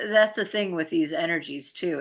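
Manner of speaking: usual speaking voice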